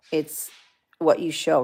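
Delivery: ordinary talk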